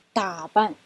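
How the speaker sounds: 打扮 with 打 stressed, 扮 light; neutral tone demo. Shown as a demonstration. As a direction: say 打扮 with a quick light 扮. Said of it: "打是重音".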